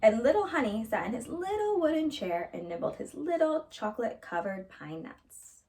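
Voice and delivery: ordinary delivery, nothing marked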